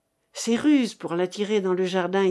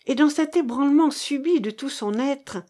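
usual speaking voice

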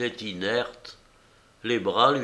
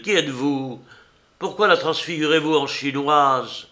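louder, rising angry demand; 5 semitones higher, 5 dB louder than usual. Acted. Painter confronting the old enameller, angrily demanding. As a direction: press her hard for an answer.

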